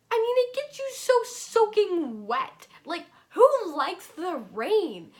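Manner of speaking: high, loud, drawn-out exasperation; exasperated rant. Real, not acted. Vlogger complaining about getting soaked by rain, exasperated. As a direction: stretch the words out in loud disbelief.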